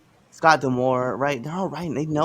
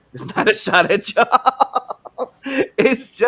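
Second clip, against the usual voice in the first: talking through laughter, higher and louder; laughing; 8 semitones higher, 7 dB louder, much slower than usual. Real, not acted. Host apologizing to Southerners for the accent, laughing. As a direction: break up laughing as you talk.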